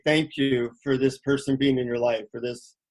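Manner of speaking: low, sincere gratitude; heartfelt thanks. Shown as a demonstration. Speaker shows listeners how to thank loved ones, heartfelt. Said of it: "try to feel your heart and say".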